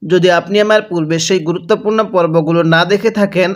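ordinary talk, nothing marked

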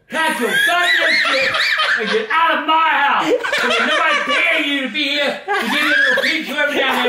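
laughing angry yell; angry shout. Real, not acted. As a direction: bellow it, half-laughing. Grandpa furious about payment.

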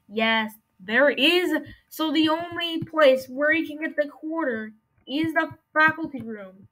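ordinary talk, nothing marked

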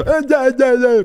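high, loud flustered yelps; startled protest. Acted. Shoplifter told to pay, startled and flustered. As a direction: blurt it loudly, caught in the act.

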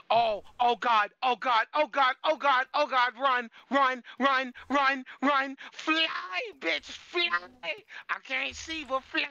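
panicked high frantic yelling; frantic high-pitched panic; terrified horror victim. Real, not acted. Fleeing the Warden in panic.

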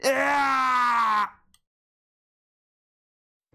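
long drawn-out frightened scream; terrified scream. Real, not acted. Streamer jump-scared in a horror map, long terrified scream. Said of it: "クソ怖えや".

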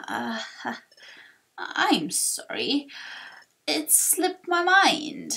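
slow elder, groping for the words; forgetful old sage. Acted. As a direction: stall with long ums, then apologise feebly. Torkoal forgets.

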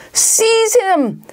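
high, shrieked order; villain's command. Acted. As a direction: order the minions to attack. Villain orders spiders to attack.

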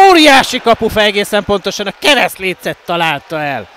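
loud, high cry at the crossbar; excited commentary. Real, not acted. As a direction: burst out at the near miss. Near miss hits crossbar. (izgatott, hangos kiáltás)

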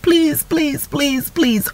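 light, high-pitched repetitive begging; mock pleading. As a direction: imitate someone begging over and over. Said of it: "we don't need to please every day".

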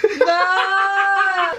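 laughing shout. Real, not acted. Laughing protest at players.

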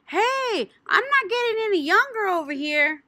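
high, loud huffy shout; impatient shout. Acted. Junie B shouting at the waiting cake lady. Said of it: "I shouted".

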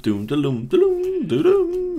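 sung-out spinning logo noise; imitated intro jingle. Vlogger describing a short intro, imitating its sound. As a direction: voice a little intro sound effect. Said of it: "it's just a little thing spins around".

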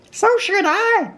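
very high character voice; Rabbit. A narrator's reading. Rabbit answering his guest Pooh, very high voice. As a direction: pitch it way up, small and proper.

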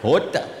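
"Water" low, crisp t, no final r; South African pronunciation. Shown as a demonstration. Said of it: "That's how I say it".

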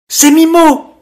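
high, loud surprised exclamation; astonished. A narrator's reading. Mermaid recognising the little fish, high and surprised. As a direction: gasp out his name in surprise. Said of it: "s'étonna Adèle".

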